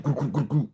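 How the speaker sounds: cloop, cloop, cloop, cloop as quick high pops; imitated popping noise. Shown as a demonstration. Telling the crowd how the food went down, high popping clooping. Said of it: "you could hear the hot dogs go cloop".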